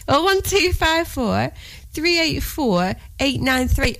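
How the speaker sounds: number read out slowly; slow and clear. Shown as a demonstration. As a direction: spell out each digit slowly. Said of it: "Get your pads and pens ready".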